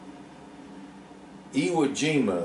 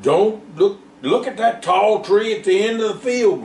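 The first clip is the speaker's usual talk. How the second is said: grunted; 8 semitones higher than usual. A narrator's reading. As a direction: push the words out while pulling. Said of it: "grunted Joe".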